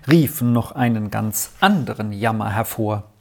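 usual speaking voice

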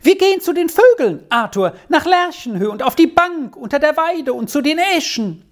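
put-on high, breathless-with-joy child's chatter; child's excited yell. A narrator's reading. Squirrel plans the outing with Arthur, excited high-pitched cries. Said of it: "schrie Squirrel".

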